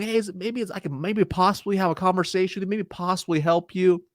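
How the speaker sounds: high-pitched timid hedging; super soft, tentative. Shown as a demonstration. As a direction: tiptoe through the ask. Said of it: "like, super soft".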